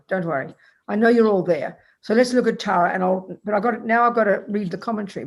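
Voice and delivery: usual speaking voice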